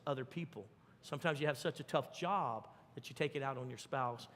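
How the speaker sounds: ordinary talk, nothing marked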